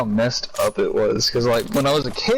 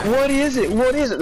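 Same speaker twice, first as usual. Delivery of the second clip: put-on excited kids; 12 semitones higher than usual. Voicing the kids jumping about, high and eager. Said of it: "they're like".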